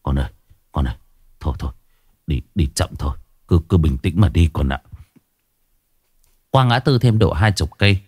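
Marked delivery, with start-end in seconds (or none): 0.0-4.8 lower, much softer, out-of-breath plea; panting. Acted. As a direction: gasp out the words after the scare. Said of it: "thở hồng hộc".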